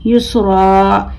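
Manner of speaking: युसरा with the final aa drawn out; long alif ending. Shown as a demonstration. Teacher showing pupils the alif in युसरा, long held ending. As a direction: hold the last aa of युसरा slowly. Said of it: "अलिफ के साथ ही एक ज़बर".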